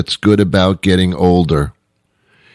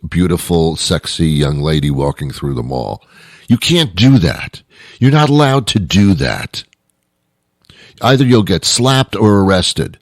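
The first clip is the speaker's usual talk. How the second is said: normal talking voice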